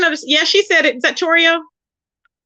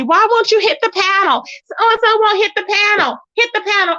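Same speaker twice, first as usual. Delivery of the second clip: mocking Jazzy; 7 semitones higher than usual. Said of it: "She wants to get up there and roast".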